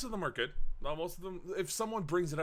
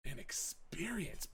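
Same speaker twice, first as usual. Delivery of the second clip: whisper; 8 semitones higher, 10 dB quieter, slower than usual. Real, not acted. Trailing wistful remark on game.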